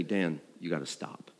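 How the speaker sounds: quiet caring plea from a worried wife; a hushed, tender stop-it; wife's gentle warning. Retold or imitated. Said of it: "She said to me".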